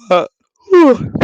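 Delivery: a long high yawn breaking through the word; real yawn. Real, not acted. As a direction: let a real yawn take over. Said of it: "apologies for the yawn there".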